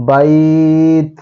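বাইত with the ai stretched one alif long; slow stretched বাইত; drawn-out bait. Shown as a demonstration. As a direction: hold the vowel in বাইত for a full alif count. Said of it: "সঠিক উচ্চারণ".

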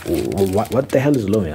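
normal talking voice

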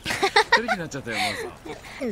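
high teasing sing-song; flirty high voice. Acted. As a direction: coo it playfully.

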